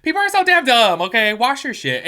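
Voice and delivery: exasperated yell. Retold or imitated. Ranting about a clueless friend, loud exasperated yell. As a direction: shout it like you've had enough. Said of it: "I'm just like".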